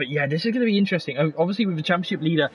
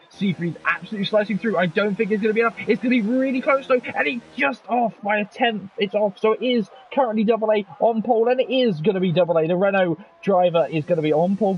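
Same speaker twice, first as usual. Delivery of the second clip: live play-by-play; 8 semitones higher, 3 dB louder than usual. Acted. Live qualifying commentary.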